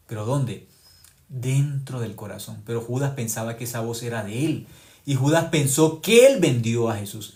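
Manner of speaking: usual speaking voice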